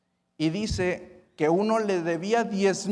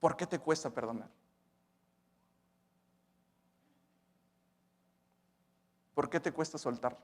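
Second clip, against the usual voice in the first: softer, gentle questioning; quiet pastoral question; 8 dB quieter than usual. Real, not acted. Preacher asking the congregation softly why they cannot forgive. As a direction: ask it gently, almost privately. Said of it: "soft pastoral question after pause".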